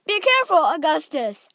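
high, loud warning; father shouting a warning; shouted. A narrator's reading. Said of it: "shouted Mister Gloop".

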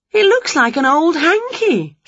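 high witch voice, let down; disappointed witch. Acted. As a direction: sound deflated yet shrill. Winnie disappointed.

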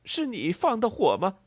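high, loud, suspicious question; agitated accusation. A narrator's reading. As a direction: throw the accusation at the listener. Farmer accuses her of arson. (激动地高声质问)

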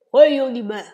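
loud beaver greeting. Acted. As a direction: call out a welcome as a beaver.

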